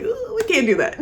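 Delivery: high, giggling mock protest; giggly protest. Penelope stopping Colin, high giggling protest. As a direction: tease it like a flustered lady.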